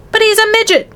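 high-pitched appalled father; acted outraged shout. A narrator's reading. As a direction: exclaim it in shock, pitch way up. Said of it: "shouted Mister T V".

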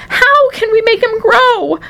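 slow, high, pleading wail; distraught mother, pitch way up. A narrator's reading. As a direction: wail for help, drawing it out. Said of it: "wailed Missus T V".

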